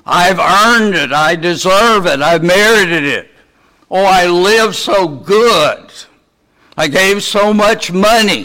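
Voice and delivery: a preacher mimicking a self-satisfied churchgoer at heaven's gate; self-righteous pleading. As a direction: ham up a smug believer demanding entry. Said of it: "I'm not gonna be able to stand and say".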